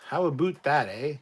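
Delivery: hammed-up Canadian "aboot"; Canadian accent. Shown as a demonstration. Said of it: "really recognizable Canadian accent".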